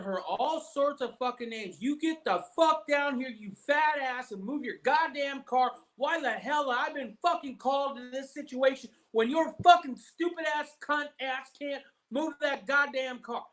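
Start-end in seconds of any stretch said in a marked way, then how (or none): 1.8-13.4 raised, high mock-angry cop berating; a high, mock-furious cop yelling insults; imitating cop's tirade. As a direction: rant at her like a rude cop. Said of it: "This is how he's talking to my wife".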